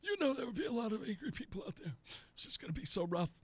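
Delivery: fast, soft echo of the senator; mimicking the senator. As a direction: parrot his line back, quick and quiet. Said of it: "repeats the senator's line".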